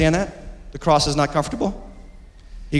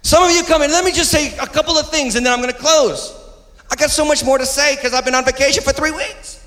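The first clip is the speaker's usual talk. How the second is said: full-force preaching; 10 semitones higher than usual. Real, not acted. Pastor scolding the congregation about seats, preaching hard. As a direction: press the congregation hard on where they sit.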